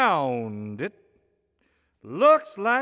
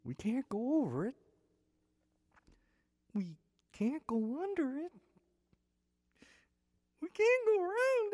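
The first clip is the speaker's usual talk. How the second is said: soft and hushed; 13 dB quieter than usual. A narrator's reading. Entering the gloomy cave.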